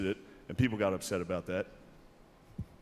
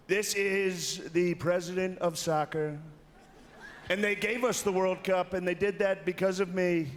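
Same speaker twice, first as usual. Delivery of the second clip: very slow, higher Trump voice; Trump impression; 8 semitones higher, slower than usual. Trump introducing the soccer president, slow Trump voice. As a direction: boast it slowly like Trump. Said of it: "His Trump impression is actually really good".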